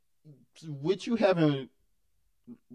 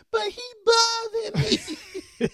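put-on whiny child; 5 dB louder than usual. Dad mimics his son whining in excuse. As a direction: whine like a little boy. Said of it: "Torrance, you don't have to scream".

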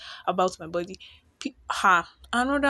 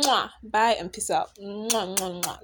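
between kisses; slower than usual. Real, not acted. Blowing kisses goodbye.

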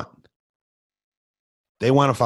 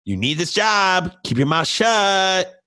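imitating his wife, higher and slow; wife's voice; 9 semitones higher, slower than usual. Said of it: "it's my wife's voice".

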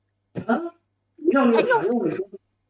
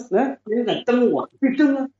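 angry dare; 4 semitones higher than usual. Acted. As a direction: dare her to do it. Husband daring wife to kick.

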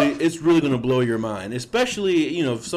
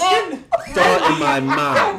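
high, slow, shouted through laughter; laughing; 6 dB louder, much slower than usual. Real, not acted. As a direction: crack up while shouting it. Laughing at the thought.

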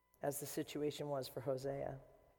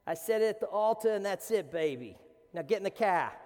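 a hammed-up Boston accent, higher and louder; 3 semitones higher, 7 dB louder than usual. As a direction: play a gruff Boston husband with a thick Boston accent, loud. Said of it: "I should do it with an, a Boston accent".

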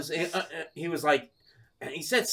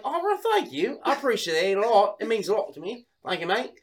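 higher, put-on Aussie gratitude; Australian accent; 6 semitones higher than usual. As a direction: say thanks as a fake Australian. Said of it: "I just love your accent".

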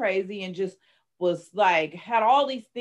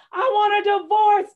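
much higher, louder cry of I want a divorce; screamed quote; 11 semitones higher, 8 dB louder than usual. Retold or imitated. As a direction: shout it out in despair.

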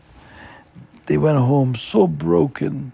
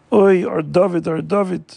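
a mild mock wail, pitched higher; crying out; 6 semitones higher than usual. Parents crying out for their taken son, a mild mock wail. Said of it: "began to cry and scream".

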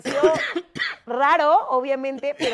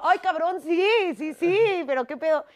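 startled; 6 semitones higher, 7 dB louder than usual. Retold or imitated. Startled by the shout.

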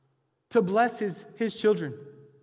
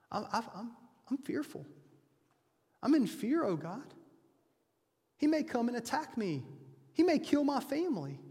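hushed, higher-pitched admission of fear; fearful confession; 4 semitones higher, 4 dB quieter than usual. Acted. Jacob confessing to God that he fears his brother, mildly fearful. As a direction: confess your fear to God, halting and softly. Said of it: "He confesses".

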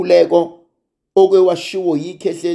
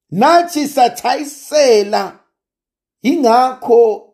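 preaching; 11 semitones higher than usual. Acted. Preaching at full force.

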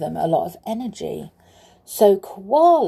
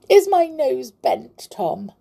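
sobbing; 6 semitones higher than usual. Acted. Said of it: "he sobbed".

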